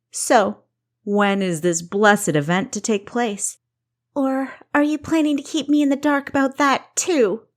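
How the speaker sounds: high, loud, venomous sarcasm; sarcastic. Acted. Kessa mocking her sister's secret wedding plans, venomous sarcasm. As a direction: drip acid on each word. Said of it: "Sarcasm dripped from every syllable".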